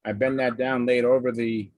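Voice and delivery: ordinary talk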